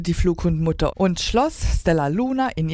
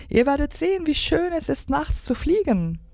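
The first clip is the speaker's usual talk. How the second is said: high-pitched, eager promise; excited; 11 semitones higher than usual. A narrator's reading. Stella Luna praises flying at night, excited. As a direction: keep it high and thrilled. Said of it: "sagte Stella Luna aufgeregt".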